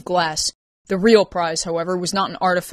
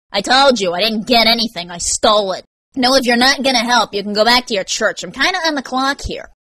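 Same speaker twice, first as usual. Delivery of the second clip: tag; 5 semitones higher, 6 dB louder than usual. Acted. Defensive, impatient brush-off.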